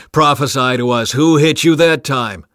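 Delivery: loud, jeering challenge; mocking. A narrator's reading. Guards to blindfolded Jesus, mocking. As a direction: taunt the blindfolded prisoner loudly. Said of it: "began mocking and beating him".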